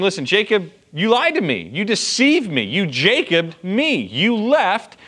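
mock indignant rant listing grievances; aggrieved accusation. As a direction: jab each charge at Jacob. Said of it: "proven a point of saying".

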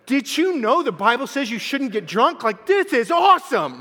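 high, loud amazed gushing; excited yelling. Retold or imitated. New believer telling his roommate what the Bible says, excited yell. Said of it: "I'm yelling to Brandon".

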